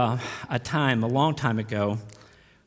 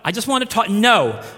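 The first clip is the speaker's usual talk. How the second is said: louder, higher, a curt mock refusal; angry refusal; 6 semitones higher, 6 dB louder than usual. Furious husband refusing to sit down with pastor. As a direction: bark it back like the furious husband.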